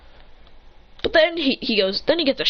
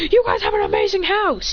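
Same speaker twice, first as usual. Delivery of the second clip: higher-pitched, impressed outburst; amazed exclamation; 7 semitones higher than usual. Acted. Character impressed by the clan's house, amazed exclamation. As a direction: exclaim it with a lifted voice.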